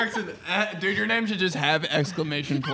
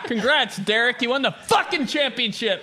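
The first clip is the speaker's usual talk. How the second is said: higher, slightly raised mock yell; mock angry praise; 6 semitones higher than usual. Dad mock-yelling congratulations at son Derek. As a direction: bark the congrats. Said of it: "even if you were doing something good".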